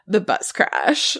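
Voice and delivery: put-on lightly drawn-out Valley Girl voice; a hint of bubbly Valley Girl; Valley Girl accent. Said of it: "In a Valley Girl accent".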